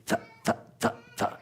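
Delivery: "th-" as brief unvoiced puffs; tight th. Shown as a demonstration. Teacher correcting a too-loose sound, short puffs. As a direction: release "th-" quickly off the tongue. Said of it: "that's too loose. It's".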